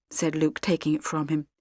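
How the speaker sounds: ordinary delivery, nothing marked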